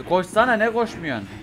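shouted complaint while being chased; frustrated yelling. Real, not acted. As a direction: urge the character on in exasperation. Character won't run while chased.

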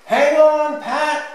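high, full shout of warning; loud voice. A narrator's reading. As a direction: shout it across the playground. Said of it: "Mummy says in a loud voice".

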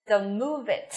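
subdued command, pitch dropping on "move it"; falling intonation. Shown as a demonstration. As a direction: step down onto "move it". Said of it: "hai câu này đều xuống giọng hết".

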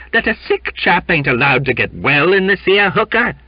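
high, loud, indignant question; ominous challenge. A narrator's reading. Elderly seaman defending a sick chap, ominous and indignant. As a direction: press the captain with rough defiance. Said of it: "he asked ominously".

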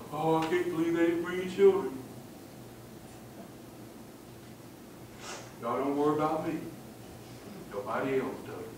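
low, soft, mopey muttering; meek, downcast grumble under the breath; cowardly self-pity. Acted. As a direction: play a wounded martyr feeling sorry for himself. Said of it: "That's cowardice".

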